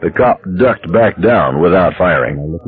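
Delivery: plain reading voice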